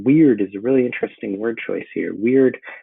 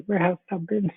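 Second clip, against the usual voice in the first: witch voice; 10 semitones higher than usual. Acted. Teacher voicing first witch.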